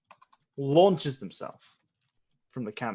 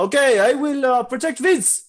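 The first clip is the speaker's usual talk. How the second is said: higher, louder eager reply; Francois voice; 11 semitones higher, 7 dB louder than usual. Acted. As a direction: answer like an eager companion.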